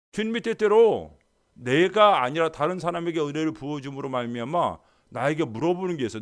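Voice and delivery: ordinary talk, nothing marked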